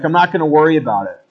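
quick, offhand shrug of a line; breezy brush-off. Retold or imitated. Paul shrugging off the snakebite, fast and offhand. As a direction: toss it off fast and easy. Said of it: "he's like".

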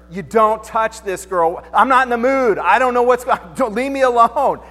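hammed-up grumpy wife, don't wake me; gangster wife. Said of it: "My wife goes gangster".

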